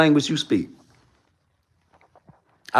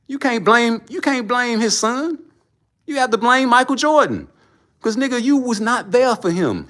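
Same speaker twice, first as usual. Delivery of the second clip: higher, heated blaming rant; 9 semitones higher than usual. Real, not acted. Vlogger heatedly blaming Michael Jordan to his viewers, raised voice. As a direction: pitch it up and blame the father hard.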